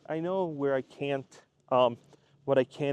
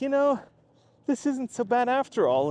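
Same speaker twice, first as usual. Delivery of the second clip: pleased surprise; 8 semitones higher than usual. Pretend. Creator realizing vlogging is fine, higher and pleased. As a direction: sound like it's dawning on you.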